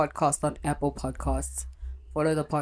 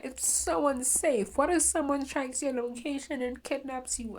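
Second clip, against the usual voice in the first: higher fretting mother voice; mock-worried mom, pitched up; imitating worried mom; 7 semitones higher than usual. Mom fearing her daughter's social media, higher anxious voice. Continues voicing mom's worries.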